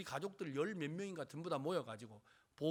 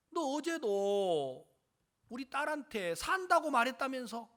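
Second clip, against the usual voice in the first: mildly raised, higher accusing voice; cursing accusation; 7 semitones higher, 5 dB louder than usual. Retold or imitated. Dying daughter's parent confronts him over false hope, accusing. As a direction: imitate an angry parent's challenge. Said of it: "막 욕을 하면서".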